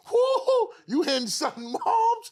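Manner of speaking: high, loud whooping hype. Retold or imitated. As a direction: cheer her on like the block does. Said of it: "we go".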